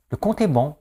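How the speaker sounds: high imitation of a numbers-game winner; game-show voice. Host parodying a TV numbers game, high quiz voice. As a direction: declare the result in a put-on high voice. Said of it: "Ou des chiffres".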